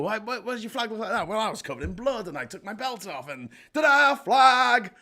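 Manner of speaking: high, animated mock dialogue building to a loud ta-da; high-pitched showman's flourish; acted legend retelling. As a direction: play the flag's proud inventor, ending in a big reveal. Acts out the flag legend.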